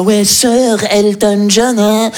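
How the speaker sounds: slow, snooty drawl of Sir; mock a smug Parisian; slow haughty mockery of the Parisian; condescending. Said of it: "la condescendance qu'il avait dans sa voix".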